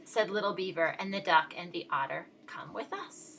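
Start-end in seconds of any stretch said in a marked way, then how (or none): 2.5-3.3 whisper. A narrator's reading. Friends invite the lonely turtle.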